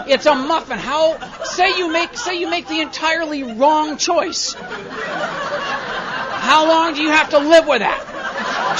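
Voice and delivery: loud, high exploding rant; furious rant. Retold or imitated. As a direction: blow up at the dithering customer. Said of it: "I thought".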